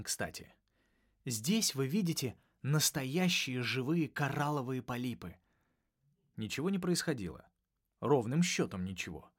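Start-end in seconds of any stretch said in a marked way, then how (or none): none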